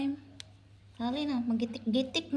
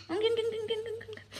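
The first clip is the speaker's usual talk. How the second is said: put-on playful baby noises; 9 semitones higher, faster than usual. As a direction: babble high to amuse a baby. (tinis nga tingog pangdula sa bata)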